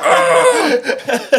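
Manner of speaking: sudden high cry of panic, half laughing; mock panicked yell. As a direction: yelp like you've just been caught. Caught, panicking in the story.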